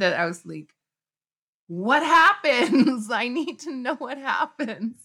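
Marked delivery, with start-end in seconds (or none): 1.7-5.0 high, loud, slow pleading through a laugh; laughing plea. Retold or imitated. Child slamming book on teacher's desk, laughing desperate plea. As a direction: laugh while begging to know the ending.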